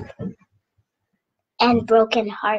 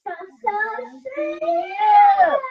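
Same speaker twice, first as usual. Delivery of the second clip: shouted affirmation; 12 semitones higher, 4 dB louder than usual. Real, not acted. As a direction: scream it with joy. Child shouts back affirmations.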